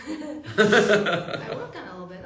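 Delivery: loud laugh through the words. Real, not acted. Joking about a poor Scottish accent, laughing loudly. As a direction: laugh it out while answering.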